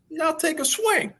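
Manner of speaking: a hammed-up high mentor voice coaching a player; imitating Ken's voice. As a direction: play the old mentor in a high put-on voice. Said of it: "Ken's got this great voice".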